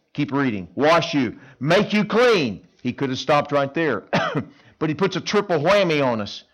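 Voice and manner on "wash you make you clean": slow, weighty Wash you. Make you clean; emphatic. Shown as a demonstration. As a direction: lean on clean. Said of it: "double emphasis".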